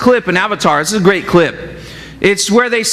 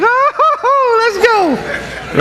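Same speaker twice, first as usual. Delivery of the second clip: much higher, squealing Oh, let's go; wife squeals back, thrilled, in a high voice; excited wife; much slower than usual. Retold or imitated. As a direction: gush like a swooning wife. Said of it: "She'd be like".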